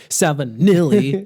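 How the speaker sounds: stretched-out Italian "Salvinelli"; Italian pronunciation. Shown as a demonstration. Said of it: "that's how Italians say it".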